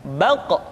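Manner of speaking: "Baq" with an echoing release after the q; "Baq" ending in a thick popped q; bouncing final qaf. Shown as a demonstration. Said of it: "maka terdengar pantulan suara".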